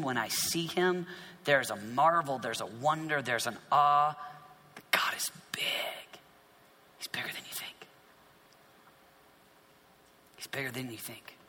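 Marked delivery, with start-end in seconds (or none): 4.9-6.2 slow, breath-only whisper; awed whisper. Real, not acted. Preacher on the awe of God, whispering. 7.0-7.9 very soft, voiceless whisper; awed whisper. Real, not acted. Preacher tells listeners of God's size, whispering. Preacher hushed, first of two takes. 10.4-11.4 very soft but voiced; hushed awe. Real, not acted. Preacher repeats God's greatness to listeners, hushed.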